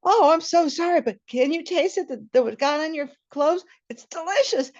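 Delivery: high, loud giddy apology; giddy excited. Retold or imitated. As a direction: gush about the sauce.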